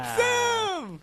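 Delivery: loud, very high squealed cheer; mock elation. Co-host greets news of returning guest Sam, mock elation. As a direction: shriek the name like a delighted fan.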